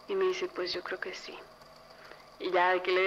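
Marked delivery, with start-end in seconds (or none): 0.5-1.4 super serious. Retold or imitated. Mom answering daughter about the visitors, super serious. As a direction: imitate mom answering softly, in all seriousness. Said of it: "me volteé a ver así súper seria".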